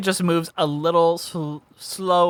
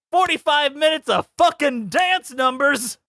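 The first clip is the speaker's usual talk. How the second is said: pitched way up, punching the swear; exasperated outburst; 9 semitones higher than usual. Real, not acted. Griping to cohosts about endless dance numbers, exasperated high outburst. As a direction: burst out in mock outrage at the dance numbers. Ranting about the cut movie content.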